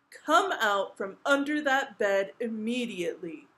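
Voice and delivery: loud, angry king's order; forceful, commanding; thundering command. A narrator's reading. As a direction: order the child out in a big royal voice. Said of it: "thundered the king in such a loud voice".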